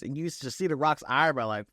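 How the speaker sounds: ordinary talk, nothing marked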